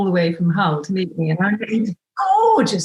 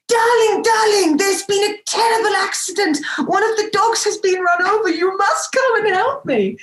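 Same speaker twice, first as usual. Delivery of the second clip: panicked friend on phone; 13 semitones higher than usual. Retold or imitated. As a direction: shriek darling like a drama queen. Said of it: "he was playing the part at the end of the phone".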